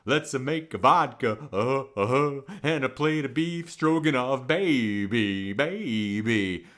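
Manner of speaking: low mock [name] crooning baby, baby; Russian [name]. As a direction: croon the vodka order low. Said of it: "I translate that into".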